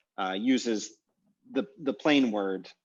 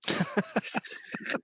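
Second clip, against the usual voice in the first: high, wheezy laugh; helpless laughter; 11 semitones higher than usual. Real, not acted. Reacting to the Zit lookalike, helpless high laughter. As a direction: crack up, mostly breath.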